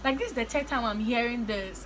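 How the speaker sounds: normal talking voice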